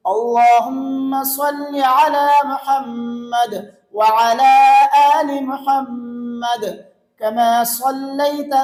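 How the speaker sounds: slow chanted recitation, pausing after each phrase; pausing between phrases. Shown as a demonstration. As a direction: hold each phrase, then stop. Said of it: "اگر ٹھہر ٹھہر کر پڑھیں گے تو یوں".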